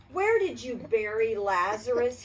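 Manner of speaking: ordinary delivery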